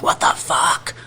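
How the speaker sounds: whispered, drawn-out cursing in disbelief; breathy, voiceless exasperation; exasperated whisper. Retold or imitated. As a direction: whisper it as a retold moment of frustration. Frustrated reaction to broken dryer.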